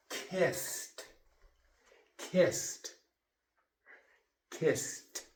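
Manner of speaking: "Kissed" with an unvoiced, whispered t ending; voiceless ending. Shown as a demonstration. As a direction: breathe out the final t of "Kissed". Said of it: "There's no vocal cord in".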